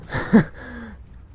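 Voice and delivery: loud high burst of laughter. Real, not acted. Laugh amid outburst.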